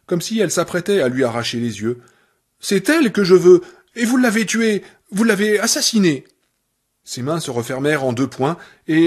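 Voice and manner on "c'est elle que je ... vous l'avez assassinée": loud and high, hitting vous; furious accusation. Acted. Annie accusing Paul of killing Misery, furious. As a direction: jab every vous at him.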